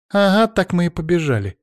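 high, pointedly loud retort; deliberately loud. A narrator's reading. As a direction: answer back loudly so others hear. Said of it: "ответила нарочито громко Лиза".

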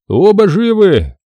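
loud, high, slow over a crackling speaker; joyful report over the radio. A narrator's reading. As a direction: shout the news through the radio. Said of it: "прозвучало в динамике".

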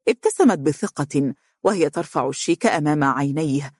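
plain reading voice

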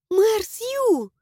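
high, glad and eager; excited girlish voice. A narrator's reading. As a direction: call out happily in a high voice. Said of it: "обрадованно зашептала Флэша".

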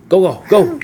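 high, loud shooing; pushy little command; urgent ordering. Acted. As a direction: hustle someone along. Rats push Pedro back to couch.

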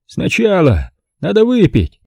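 a confident host relishing his plan; Stanton's voice. A narrator's reading.

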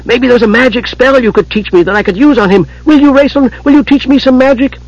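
very high, excited kender voice; brightened, eager. A narrator's reading. Tass begging Raistlin to teach him magic, eager. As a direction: plead hopefully, pitch climbing high. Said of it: "then brightened".

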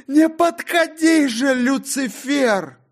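shrieking top-of-lungs plea; screaming terror. Acted. John screams at Lucifer in full terror. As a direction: scream it at the devil. Third time shouted.

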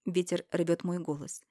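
ordinary delivery, nothing marked

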